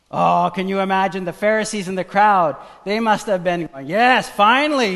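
loud and high, driving the sermon home; stirred-up pulpit voice; fiery preaching. Acted. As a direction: preach it full force to the crowd. Preaching at full force.

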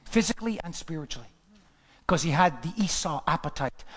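ordinary delivery

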